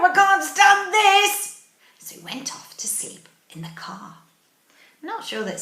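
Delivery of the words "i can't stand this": loud, high, slow storybook complaint; exasperated Mister Bear. Acted. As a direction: wail it slowly, utterly fed up. Fed-up bear exclaims in story.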